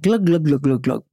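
glugging pour sound. Retold or imitated. Imitating wine being poured.

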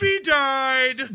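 high, drawn-out holler; gleefully stretched high call; mock blurting. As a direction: sing out the spoiler. Blurting out film spoiler.

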